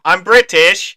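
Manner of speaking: high, loud hammed-up British voice; mock Cockney. Co-host mocking the actor's bad Cockney accent. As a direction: ham up a terrible Cockney.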